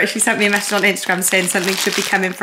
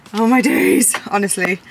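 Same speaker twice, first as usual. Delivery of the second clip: ordinary delivery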